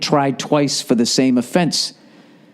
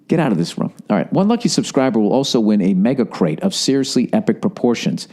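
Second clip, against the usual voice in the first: ordinary talk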